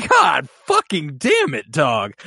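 mock anguished outburst; overwhelmed exclamation. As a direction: curse at how hard the scene hit. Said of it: "I was like".

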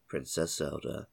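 ordinary talk, nothing marked

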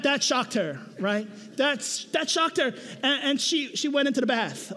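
usual speaking voice

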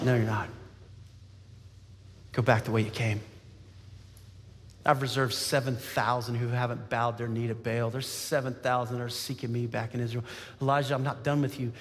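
God answering low and steady; low, lightly voiced reassurance from God; still small voice. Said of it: "God's in the still, small voice".

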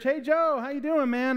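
high-pitched, chummy hello; mock hearty greeting. Churchgoer greeting Joe at church, mock hearty.

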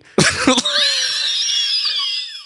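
high shrieking laugh. Real, not acted. Uncontrollable laughter.

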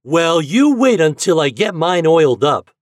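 put-on high, loud boyish retort; warning. A narrator's reading. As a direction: warn a rival playfully. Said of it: "warned Reddy".

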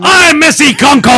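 loud, high shout of the name. Acted. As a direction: yell it out with excitement. Dating game contestant, shouted.

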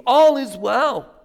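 high, loud and drawn-out call to the king. Acted. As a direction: call it out slowly across the distance. Messenger calls out to the king.

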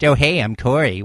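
closer to Corey's own tone, said brighter; current Corey impression. Host doing his current Corey impression, lightly voiced. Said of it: "And now it's".

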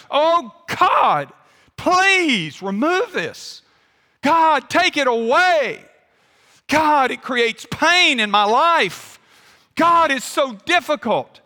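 loud, high, drawn-out cries to God; anguished petition, pitched high and pushed hard; intense pleading. Acted. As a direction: beg earnestly, stretching the words at full voice. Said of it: "It is an intense, uh, a pleading with God".

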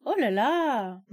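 a bright exclaimed "Oh là là!" with a lifted tune; surprised oh là là. Shown as a demonstration. Host demonstrates French surprise, exclaiming "Oh là là!". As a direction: show surprise at waking at four. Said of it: "O que vai mudar é a entonação".

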